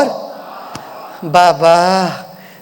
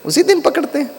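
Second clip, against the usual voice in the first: higher, puzzled grumble; half-joking protest, pitched up; amused disbelief, higher; mock complaint; 8 semitones higher than usual. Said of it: "मैं कहता".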